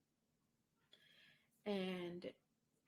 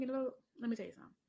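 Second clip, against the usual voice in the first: ordinary delivery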